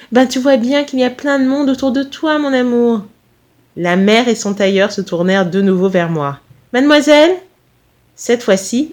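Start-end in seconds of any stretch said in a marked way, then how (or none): 0.1-3.0 higher, cooing maternal voice; mother hen talking to her darling; coaxing mother. Acted. Mother coaxes son tenderly. 6.7-7.3 high, loud call with rising end; raised, enquiring hail. Acted. Mother hails narrator again.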